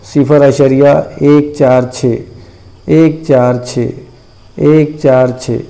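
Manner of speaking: stretched-out, slowed recital of the repeating digits; slow counting to show the repetition; deliberate teacher's slow repetition. Shown as a demonstration. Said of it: "एक से ज़्यादा अदद repeat".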